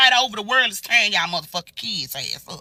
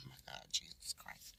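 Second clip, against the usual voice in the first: breathless whispered exasperation; drained whisper; 13 semitones lower, 32 dB quieter than usual. Real, not acted. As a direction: whisper it worn out. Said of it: "bitch, I'm sweating".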